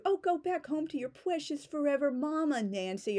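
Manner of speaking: high, sing-song schoolyard teasing; mock a classmate's sappy journal; jeering high voice; mocking taunt. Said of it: "they would make fun of teenagers".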